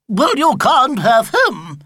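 put-on high, loud, slow old lady insisting; insistent old lady. A narrator's reading. Old lady insisting to Mrs Tibblethwaite, high and loud. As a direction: dig in your heels like a stubborn granny. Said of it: "insisted the lady".

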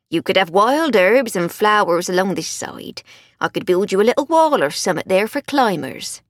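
put-on broad Yorkshire accent; old soldier with broad Yorkshire vowels. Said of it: "hadn't softened his broad Yorkshire accent".

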